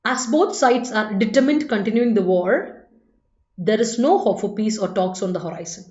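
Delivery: slow, careful English read-aloud, word by word; slow dictation reading. Shown as a demonstration. Teacher reading the exam sentence to the class, slow and careful. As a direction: read it out slowly for the students. Reads new question aloud.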